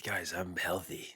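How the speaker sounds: slow soft whisper. Real, not acted. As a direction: whisper it, mock innocent. Insisting he is not sick.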